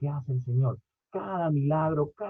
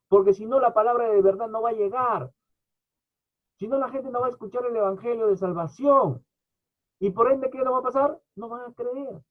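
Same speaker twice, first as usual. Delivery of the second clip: preacher warning the church, raised; urgent preaching; 7 semitones higher than usual. Real, not acted. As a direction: press the urgency home. Preacher urging evangelism.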